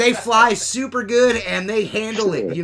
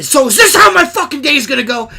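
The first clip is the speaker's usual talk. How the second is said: louder, higher fed-up complaint; exasperated outburst; 7 semitones higher, 9 dB louder than usual. Retold or imitated. Dropping his scraper, he complains out loud in exasperation. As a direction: vent at the bad day ahead.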